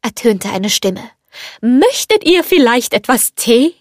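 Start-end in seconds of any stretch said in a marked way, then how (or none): 1.6-3.8 friendly. A narrator's reading. Teapot offers the girl some tea, friendly and lilting. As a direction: friendly offer in a higher character voice. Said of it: "da die Stimme so freundlich klang".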